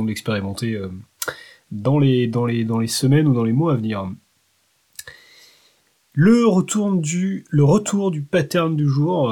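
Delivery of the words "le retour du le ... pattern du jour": segment announcement. Real, not acted.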